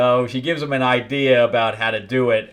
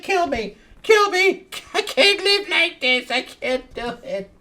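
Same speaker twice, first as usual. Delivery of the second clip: much higher, cracking cries to die; mock anguished begging. Scrambled prisoner begs to be killed, high mock wailing. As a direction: wail like a madman begging for death.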